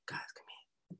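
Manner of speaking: unvoiced whisper calling them over; a secret whispered summons; whispered quote. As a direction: hush it, beckon close. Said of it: "and he was like".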